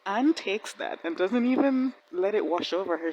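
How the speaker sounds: ordinary talk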